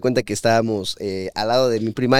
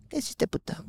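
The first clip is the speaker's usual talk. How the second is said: high, breathy retold challenge under the breath; hushed challenge; 12 semitones higher, 12 dB quieter than usual. Boy turning on the kid who shoved him, hushed challenge. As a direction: hiss the comeback at the bully.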